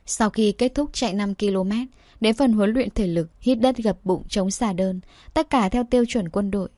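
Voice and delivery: usual speaking voice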